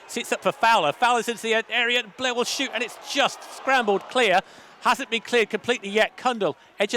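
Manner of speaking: rising, urgent play-by-play; excited commentary. Real, not acted. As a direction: lift the voice as the chance builds.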